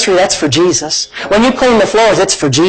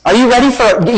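ordinary delivery

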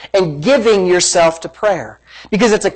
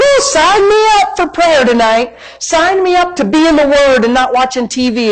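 high-pitched, gushing pretend enthusiasm; put-on excitement, pitched well up; mock eagerness; 6 semitones higher than usual. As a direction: mimic an over-keen volunteer. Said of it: "where your mind says".

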